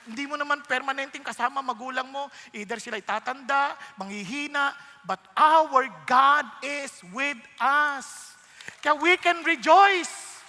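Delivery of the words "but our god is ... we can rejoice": slow, loud, emphatic preaching; sermon climax, proclaiming. Acted. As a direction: proclaim it to the congregation slowly.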